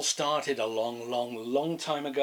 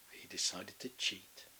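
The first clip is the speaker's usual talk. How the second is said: ordinary delivery, nothing marked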